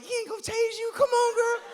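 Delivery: high, squeaky pleading voice; urging girlfriend on. Retold or imitated. To his girlfriend, urging her past the cop, in a high voice.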